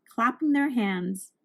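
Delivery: ordinary delivery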